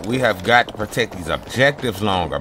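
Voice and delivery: ordinary delivery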